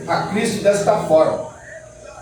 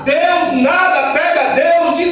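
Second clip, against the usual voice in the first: preaching at full force; 5 semitones higher, slower than usual. Acted. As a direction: shout the truth to the congregation. Preaching climax.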